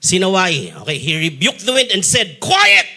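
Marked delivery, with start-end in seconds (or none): 2.4-2.9 high, loud rebuke; firm command. Acted. Jesus commands the wind, firm rebuke. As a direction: order the storm to stop.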